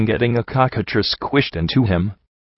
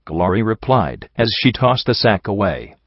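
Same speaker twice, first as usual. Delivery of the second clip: ordinary delivery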